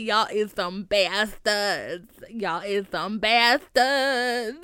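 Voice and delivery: slow and drawn out, leaning on bastards; slow mock scold, bastards stretched; slow indignant scolding. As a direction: drag out each word in mock outrage. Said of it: "I was like".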